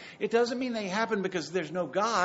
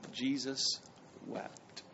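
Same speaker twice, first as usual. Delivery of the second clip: hushed reading; 6 semitones lower, 10 dB quieter, much slower than usual. Acted. Reading the verse reverently.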